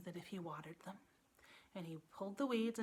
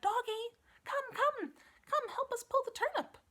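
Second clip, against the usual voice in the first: very high, loud puppy call; calling a dog; 6 dB louder than usual. Acted. Daughter calling her little dog, very high. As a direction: sing out to the puppy.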